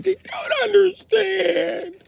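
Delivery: exaggerated high, long-drawn wail; mock women's whine. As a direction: squeal it slowly, mimicking women. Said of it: "You sound like Beaker".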